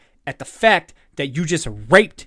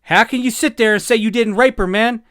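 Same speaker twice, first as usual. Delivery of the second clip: higher, louder, indignant; outraged; 5 semitones higher, 6 dB louder than usual. Real, not acted. Host confronting the laughing men, loud indignant outrage. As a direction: demand an answer in disbelief.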